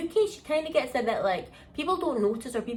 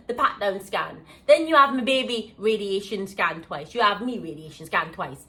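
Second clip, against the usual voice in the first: ordinary delivery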